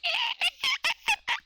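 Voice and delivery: very high, buzzing attempt at a cicada; failed cicada imitation; cicada noise attempt. Shown as a demonstration. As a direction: try to buzz like a cicada, way up high. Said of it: "How do I make a cicada noise?".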